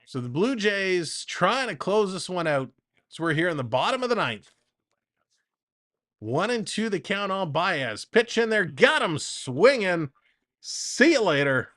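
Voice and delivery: lively baseball announcer delivery; play-by-play call. Acted. Streamer calling the Jays game for chat, announcer voice. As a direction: call the at-bat like a broadcast.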